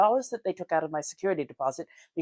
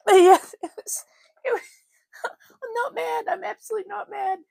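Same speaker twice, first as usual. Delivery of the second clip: very high, squeaky through laughter; amused reassurance; 5 dB louder than usual. Real, not acted. Laughing off a misunderstanding.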